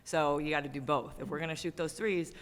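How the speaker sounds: usual speaking voice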